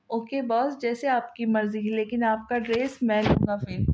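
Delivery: ordinary delivery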